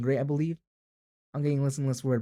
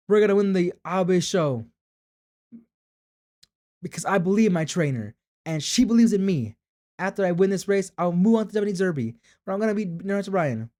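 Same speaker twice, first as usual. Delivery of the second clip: loud, higher-pitched rallying declaration; determined young racer; 6 semitones higher, 7 dB louder than usual. Acted. Racer vowing to win for the trainer, determined. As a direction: declare victory with full conviction. Voices a confident racer in game.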